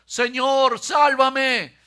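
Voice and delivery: loud, high cry for help; desperate cry. A narrator's reading. Peter sinking in the water cries out to Jesus. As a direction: shout as if sinking. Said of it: "gritó".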